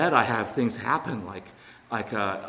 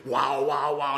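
excited; 5 dB louder, much slower than usual. Retold or imitated. The room feeling the energy.

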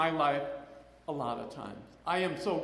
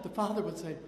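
ordinary delivery

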